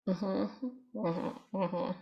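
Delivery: soft, absent-minded mm-hmm while not listening; distracted mm-hmm. Shown as a demonstration. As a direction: sound half-checked-out, eyes on a phone. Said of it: "instead of, like, looking at your phone".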